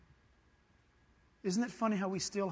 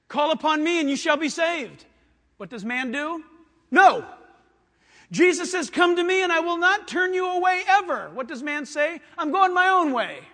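higher, loud fiery preaching; preaching at full force; 8 semitones higher, 12 dB louder than usual. Acted. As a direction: thunder it at the congregation.

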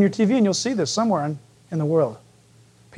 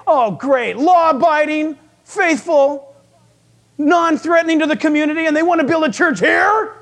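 higher, exasperated mock objection; acted sarcastic complaint; 6 semitones higher than usual. Preacher plays townspeople objecting to a new church, sarcastic. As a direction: list each virtue as if it were an outrage.

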